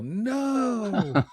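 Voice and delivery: long, high-pitched pretend cry of protest; mock yell. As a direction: wail it out high as a joking heckle. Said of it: "stand up and yell".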